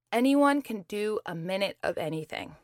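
normal talking voice

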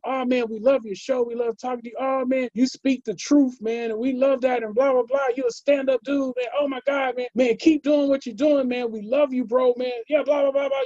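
a lightly mocked, high-pitched fan gushing. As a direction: rave at him like a starstruck fan. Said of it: "people running up".

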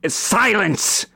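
high, forceful shouted command; bellowing giant. Acted. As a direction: cut them off with a giant's bellow. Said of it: "the giant bellows".

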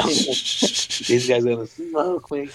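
slow, high, laughing words. Real, not acted. Seeing the others before the run, laughing at himself. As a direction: crack up while admitting it. Laughs at his fear of losing.

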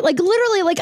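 normal talking voice

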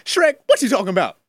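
loud motormouth Donkey impression; Eddie Murphy as Donkey. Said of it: "Here's the Eddie Murphy".

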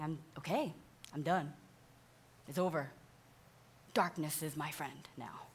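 soft and hushed, fading into a breathy whisper; defeated whisper. Retold or imitated. The psalmist telling God that darkness is his friend now, in a defeated whisper. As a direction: give up quietly, voice sinking to a whisper. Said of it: "It's kind of like he's saying".

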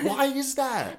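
put-on very high, laughing valley girl. Joining in co-host's bit, high valley girl. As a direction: ask it as a mock valley girl. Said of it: "like, a valley girl".

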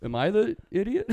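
high, loud incredulous question; bewildered, high and loud; teen realizing he was rude; baffled. Retold or imitated. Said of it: "I was like".

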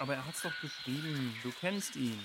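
distant argument heard through the jungle; arguing far off. Acted. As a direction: sound far away, not yet close. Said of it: "Da streitet jemand".

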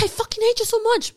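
high, loud hammed-up fury; mock hateful yell. Artist acting out a toxic partner, loud mock yell. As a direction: yell it like a toxic lover lashing out.

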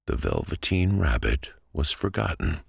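ordinary delivery

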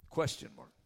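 ordinary talk

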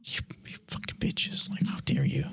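voiceless whispered indignation; mock outraged whisper. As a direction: whisper it as a betrayed kid, playfully overdone. Acted betrayed reaction to parents' prank.

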